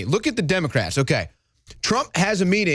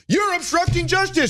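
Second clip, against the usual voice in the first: mock accusation; 10 semitones higher than usual. Mocking Democrats' accusation.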